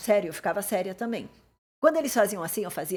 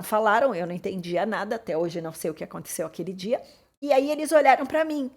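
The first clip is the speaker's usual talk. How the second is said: usual speaking voice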